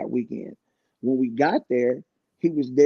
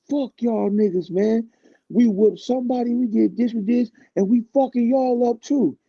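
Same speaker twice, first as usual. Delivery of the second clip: high angry threat; 9 semitones higher than usual. Retold or imitated. [name] cursing the group out, high angry threat. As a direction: play the furious rapper, voice raised high.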